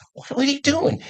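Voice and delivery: imitated alarmed wife. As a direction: voice the wife's question way up high. Wife's alarmed question retold.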